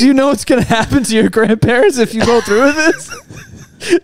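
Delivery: mock scolding parent. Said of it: "It's like".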